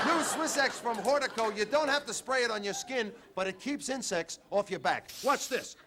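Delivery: ad read. Acted. Ad pitch.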